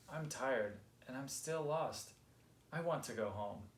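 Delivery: ordinary delivery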